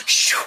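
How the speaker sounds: breathy "shh" swoosh; knife-like zip. Retold or imitated. Passenger imitating her backpack zipper, knife-like swoosh. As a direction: imitate a blade being drawn. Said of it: "made it sound like a knife".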